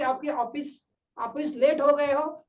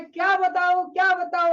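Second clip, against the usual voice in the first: higher, imitated happy man; mock amused outburst; 4 semitones higher than usual. Happy stranger asked for his reason, imitated amused outburst. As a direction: act too tickled to explain. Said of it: "फिर से हंसने लगता है".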